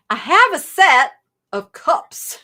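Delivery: put-on playful, slow and loud Southern accent. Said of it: "go into my Southern accent".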